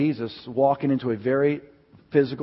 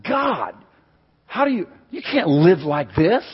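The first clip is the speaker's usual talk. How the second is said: high, retold protest to God; exasperated plea; 7 semitones higher, 7 dB louder than usual. Preacher retelling his prayer about constant weeping, high exasperated plea. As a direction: plead upward as if overwhelmed.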